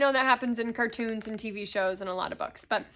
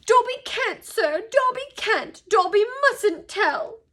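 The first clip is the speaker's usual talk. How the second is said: very high, loud elf squeal; 11 semitones higher, 7 dB louder, slower than usual. A narrator's reading. Dobby refusing to tell Harry, squealing. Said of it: "squealed the elf".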